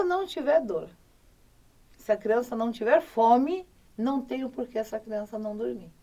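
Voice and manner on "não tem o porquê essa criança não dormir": ordinary delivery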